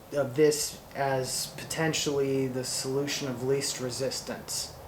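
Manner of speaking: usual speaking voice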